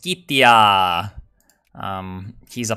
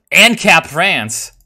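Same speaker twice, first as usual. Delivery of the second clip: louder, slow, excited announcement; 6 semitones higher, 8 dB louder, much slower than usual. Real, not acted. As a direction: savor the name like a find. Reading country name excitedly.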